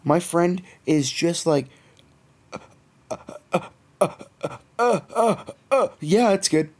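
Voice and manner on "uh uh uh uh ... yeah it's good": stunned stammer. Retold or imitated. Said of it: "And he looks stunned".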